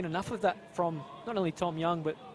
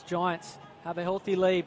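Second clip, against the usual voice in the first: ordinary talk, nothing marked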